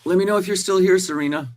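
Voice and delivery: high, playful mock-Australian call; Australian accent. Checking if best friend is still here, put-on Australian accent. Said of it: "speaking with my Australian accent".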